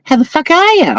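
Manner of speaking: broad Aussie greeting, pitched high; chirpy mock Aussie hello; high, bright Australian viewer impression; imitating Aussie viewers. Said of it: "you're all like".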